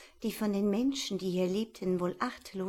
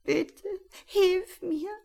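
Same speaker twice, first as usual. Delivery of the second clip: high, slow, crying owl; trapped owl sobbing for help; sobbing plea; 12 semitones higher, 5 dB louder, slower than usual. Acted. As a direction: beg through tears. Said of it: "gingen in Schluchzen über".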